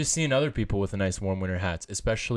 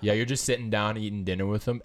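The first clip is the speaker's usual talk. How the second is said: usual speaking voice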